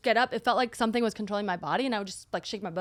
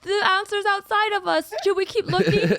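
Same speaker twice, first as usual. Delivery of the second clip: laughing it out, high and loud; 11 semitones higher, 7 dB louder than usual. Retold or imitated. Friends chatting about crying, laughing it out. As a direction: giggle through the hopeful quote. Laughing while quoting a realization.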